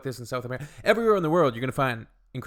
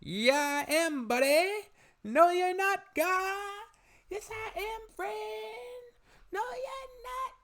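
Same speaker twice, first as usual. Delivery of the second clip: high cartoon Canadian bickering, drawn out; mock back-and-forth, pitched way up with stretched words; South Park Canadians; slower than usual. Woman imagining a South Park kind of situation, cartoonish Canadian squabble. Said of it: "like, a South Park kind of".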